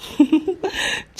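teasing giggle. Acted.

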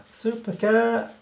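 high, drawn-out heroic call; cartoon superhero cat. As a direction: sing out a mock superhero name, high and long. Voicing the cartoon leaping cat.

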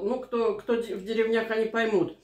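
ordinary talk, nothing marked

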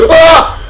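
sharp gasp into a very loud, high shriek; gasp and scream. Real, not acted. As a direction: shriek at a jump scare. Jump scare in horror game.

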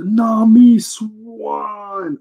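very high, very slow swooning call of "Nami Swan"; lovestruck Sanji. Retold or imitated. Sanji calling after Nami, high, drawn-out and swooning. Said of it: "he goes".

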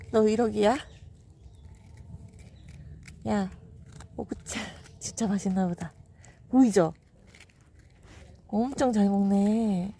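ordinary delivery

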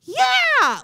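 sudden high, loud mock-cheerful yeah; a pretend upbeat shout; forced cheer. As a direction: fake it, burst out with a bright yeah. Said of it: "shove all of those emotions down and go".

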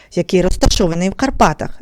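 normal talking voice

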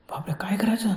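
hushed, breathy whisper of terror; whispering in fear as the train nears; panicked whisper. Retold or imitated. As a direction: whisper it trembling, out of options. Trapped man whispers in panic.

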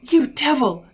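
high, loud accusing yell. A narrator's reading. Dog's owner turns on Sally, yelling. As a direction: yell it in outrage. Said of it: "I yelled".

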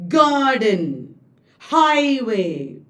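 garden, highway with first syllables long and strong; trochaic stress. Shown as a demonstration. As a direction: punch GARden, HIGHway, then let it fall. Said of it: "Trochee is".

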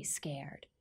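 breathy whisper on scared. Acted. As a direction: drop to a hushed whisper. Whispers the scary word.

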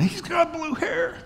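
a mock squeal on the last words; squeaky high punchline. Preacher jokes to congregation in a high squeak. Punchline about blue hair guy.

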